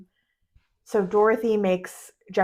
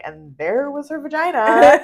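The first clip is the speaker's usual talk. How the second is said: mock deadpan; 6 semitones higher, 7 dB louder than usual. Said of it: "he was like".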